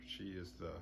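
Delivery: usual speaking voice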